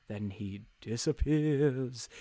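slow, drawn-out mock-ghostly narration; hammed-up eerie reveal; spooky reveal. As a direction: stretch the words out like a campfire ghost story.

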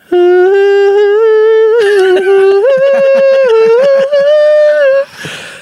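howling laugh, high and loud; loud laughter. Real, not acted. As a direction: burst out laughing hard. Big laugh after reading.

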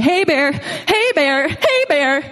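high, repeated bear-warning call; mock calling out. Hiker warning bears along the trail, mock calling out.

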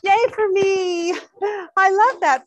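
high, loud, excited gift thanks; delighted squeal. Real, not acted. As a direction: squeal with delight over the present.

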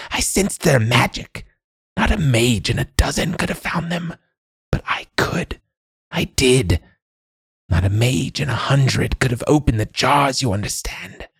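put-on high old woman, lapsing into whispers; old crone seeking approval. A narrator's reading. Old woman boasting of finding hidden scrolls, high crone voice.

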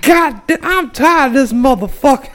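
high, loud hammed-up fury. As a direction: imitate an anchor at the end of his rope.

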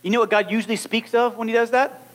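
usual speaking voice

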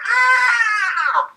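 high-pitched mock yelp easing into complaint; whiny spoiled brat. Friend voices Daisy objecting to being touched, whiny spoiled brat. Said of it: "she's such a whiny, spoiled brat".

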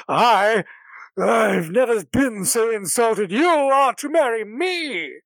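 loud, indignant and haughty; insulted noble. Acted. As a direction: hurl the insult back, proudly. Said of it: "Indigo's like".

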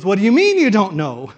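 put-on loud, incredulous angel with a light Scottish accent; little put-on Scottish accent. Angel questioning the thief at the gate, incredulous Scottish accent. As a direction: challenge the newcomer in a mock Scottish accent. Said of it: "That's my little accent".

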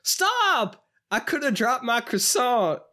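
very high, indignant mock cry; startled man impression. Man stumbling back with a plate, high startled protest. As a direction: yelp the first word, then protest high. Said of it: "just the way that he says".

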